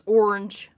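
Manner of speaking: "Orange" squeezed into one syllable; boyfriend's pronunciation. Retold or imitated. Mimicking the boyfriend, "Orange" run together as one syllable. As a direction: imitate "Orange" as ornge, middle vowel dropped. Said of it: "and he says".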